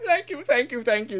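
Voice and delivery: high, rapid-fire thanks; effusive gratitude. Acted. Rescued engine to those dragging him in, grovelling thanks. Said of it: "He'd be kissing their feet".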